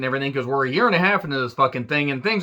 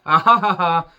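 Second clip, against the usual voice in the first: loud, higher-pitched gloating ha-ha; mocking laugh; 4 semitones higher, 5 dB louder than usual. Real, not acted. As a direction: laugh mockingly at his comeuppance. Mocks anti-masker forced to mask.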